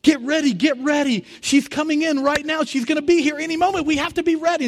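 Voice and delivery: high, loud, eager announcing voices; excited heavenly crowd. Retold or imitated. Heaven's crowd tells each other to get ready, high and eager. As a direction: call out to everyone that she's arriving.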